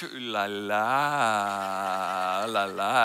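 low, soft, drawn-out French swoon; playful ooh-la-la. Acted. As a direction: linger suggestively on it, very slowly and softly. Playful remark on French wife.

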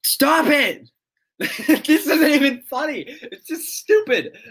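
high, exasperated yell through a laugh; yelling in protest. Real, not acted. Streamer yelling at chat that the joke isn't funny, exasperated. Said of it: "why I'm yelling".

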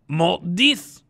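"Mot dix" said in French, high and loud; French word. Shown as a demonstration. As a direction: say "Mot dix" as a French term, pronounced clearly. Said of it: "I'm gonna say the word".

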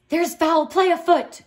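loud, high alarmed cry; the Baron cries out in alarm at the auction. A narrator's reading. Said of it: "cried the Baron".

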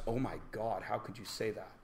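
hushed, low mock outrage; soft, low pretend horror; mock shocked student. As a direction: imitate a scandalized student under the breath. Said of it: "always somebody in the class says".

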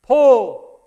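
loud, high yell at a horse. Retold or imitated. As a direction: call it across the field. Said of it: "Then he yelled".

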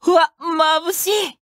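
high, slow startled outcry; surprised yelp at the light; dazzled cry. Acted. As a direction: flinch at the blinding glare.

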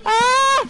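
loud startled "ah"; sudden shout. Real, not acted. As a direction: yell out to get attention. Calling out to teammate.

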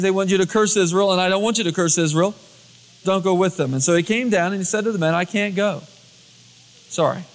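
ordinary talk, nothing marked

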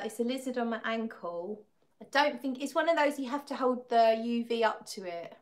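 ordinary delivery

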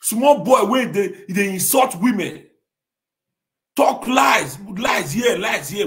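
loud, high accusing yell; angry shouting. Real, not acted. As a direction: shout him down, furious. Angry tirade against critic.

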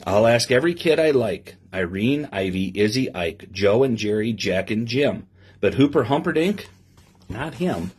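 lively storybook reading to a class; reading to children. Acted. Teacher reading a picture book to her class, lively storybook voice. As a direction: read the rhyme aloud with bounce for the kids.

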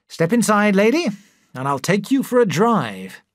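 mock chauffeur flourish; proud husband teasing his wife; playful invitation. A narrator's reading. Husband playfully showing off car.

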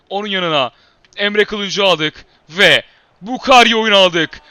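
ordinary talk, nothing marked

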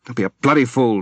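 sharp and loud rebuke. A narrator's reading. Husband snaps back at wife's accusation, sharp loud rebuke. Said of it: "he said sharply".

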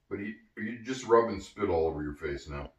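plain reading voice

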